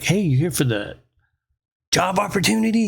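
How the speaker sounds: lightly voiced chirpy recruiter, lifting at the end; spunky recruiter. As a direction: mimic a peppy job recruiter, pitch jumping up on the offer. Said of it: "spunky fucker".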